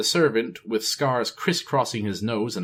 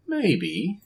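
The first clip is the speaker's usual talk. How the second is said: musing thought; 7 semitones higher than usual. A narrator's reading. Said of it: "he thought".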